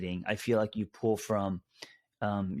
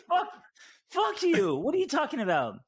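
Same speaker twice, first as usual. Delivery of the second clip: high, louder laughing mock swear; laughing fake outrage at a friend; laughing mock outburst; 3 dB louder than usual. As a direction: blurt a joking curse through laughter. Retells his joking comeback, laughing.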